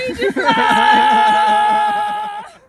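high laugh after a joke yell; loud laughter. Real, not acted. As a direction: burst out laughing. Laughing after mock panic.

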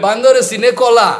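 rapid, loud rustic Bangladeshi Bengali rattle; crude Bangladeshi Bengali. Retold or imitated. A teacher mimicking an old Bangladeshi speaker's crude Bengali, rapid. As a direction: fire off the Bangladeshi Bengali saying fast and loud. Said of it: "crude Bengali language".

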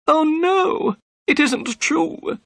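very high, distressed protest; crying out. A narrator's reading. Accused man denying murder to the inspector, crying out high. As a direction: cry out your innocence, drawing the words out. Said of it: "cried Thaddeus Sholto".

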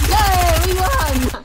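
loud, happy victory cheer. Real, not acted. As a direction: celebrate the win out loud. Celebrating the win.

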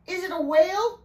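high, loud rising question; alarmed cry. A narrator's reading. Riley asks what hit the boat, alarmed cry. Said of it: "Riley cried".

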